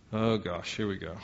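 low, soft resigned grumble; weary complaint under the breath; grumbling reluctance. Acted. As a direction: mutter it like a put-upon servant. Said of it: "grumbling and complaining servant Jesus".

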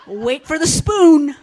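slow, booming mock threat with a laugh; loud laughing warning. Preacher jokingly warning people who refuse prayer, loud and slow. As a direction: bellow it slowly as a playful threat.

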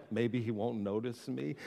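a hint of a frightened disciple barely voicing it; hushed hoping not to be noticed. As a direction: shrink into the corner and murmur it.

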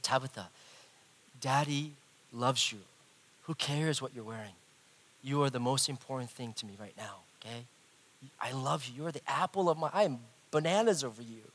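quiet, sincere retold declaration of love; tender reassurance. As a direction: reassure a daughter gently, pausing between phrases. Father tenderly reassuring his daughter.

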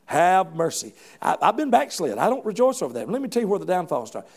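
ordinary talk, nothing marked

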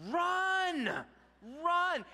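high, loud shout to get moving; urgent yell. Retold or imitated. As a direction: imitate a dad hollering at the batter. Dad urging batter to run.

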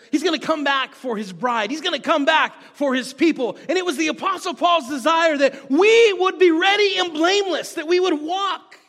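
building to a high, loud proclamation; fervent preaching. Real, not acted. Preacher proclaiming Christ will come back, building fervently. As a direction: build to a ringing pulpit climax.

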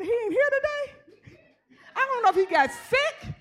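a lightly voiced, falsely puzzled aside; mock innocent wonder. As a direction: play it coy and mock-surprised.

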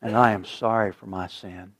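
soft, slow prayer with long pauses; slow hushed prayer. Acted. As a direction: lead the room gently in prayer. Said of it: "I'm gonna say the words".

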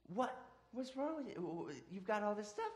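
high, surprised dad; a dad baffled by his sulking son, high and startled. Retold or imitated. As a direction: squeak out the dad's disbelief. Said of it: "He's like".

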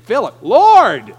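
shouted, drawn-out plea; loud exclamation. Acted. Philip jumping in on Jesus, loud exclamation. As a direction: burst in loudly. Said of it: "Philip jumps in there".

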